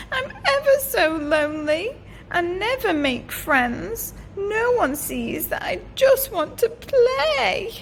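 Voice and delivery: a hint of a lonely young dragon; lonely little dragon.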